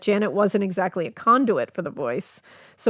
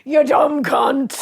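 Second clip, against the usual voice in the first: put-on slow, shouted old man insult; 4 semitones higher, 3 dB louder, slower than usual. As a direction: drag it out like a crabby old man. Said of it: "in an old man voice".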